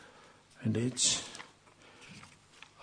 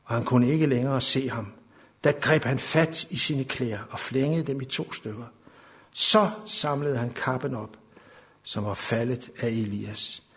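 scripture read aloud, swelling from measured to loud and high; preacher reads the Elijah story to the congregation, building to full fervour; dramatic scripture reading; 6 semitones higher, 7 dB louder than usual. Acted. Preacher to church, dramatic Bible reading.

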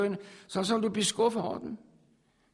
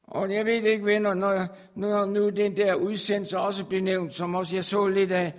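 ordinary talk

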